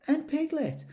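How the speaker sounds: put-on boy spotting his friend; excitedly. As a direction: burst out with delight. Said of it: "said Christopher Robin excitedly".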